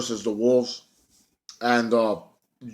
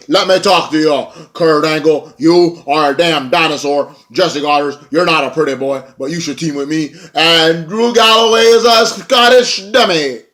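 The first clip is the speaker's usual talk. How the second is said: mock trash talk; 4 semitones higher, 6 dB louder than usual. Heel wrestler insulting Angle and Galloway, louder mock rant. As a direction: bellow insults at rivals like a heel. Eli Drake quoted trash-talking.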